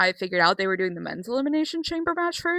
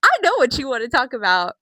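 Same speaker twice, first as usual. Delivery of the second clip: imitating Cody; 5 semitones higher, 6 dB louder than usual. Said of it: "but he goes".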